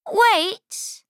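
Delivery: sudden high "Wait!" call; clever goat. Acted. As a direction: stop the troll with a quick high cry. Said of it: "She says".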